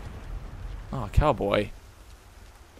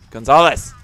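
calling out; 4 semitones higher, 7 dB louder than usual. Pretend. Calling out to game teammate.

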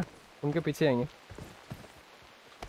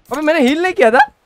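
raised, higher indignant protest; loud protest; 8 semitones higher, 8 dB louder than usual. Real, not acted. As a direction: push back loudly at the blame. Protesting teammate blame. (ज़ोर से झुंझलाकर)

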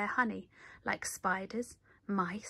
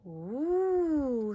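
little bees' ooh; 4 semitones higher, 3 dB louder than usual. A narrator's reading. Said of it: "said the little bees".

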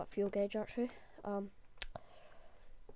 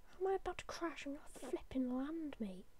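whisper; 3 semitones higher, 7 dB quieter than usual. Real, not acted. Whispered defiant reassurance.

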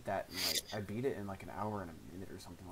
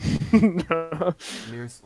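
shouting; 9 semitones higher, 19 dB louder than usual. Real, not acted. Shouting name to friend.

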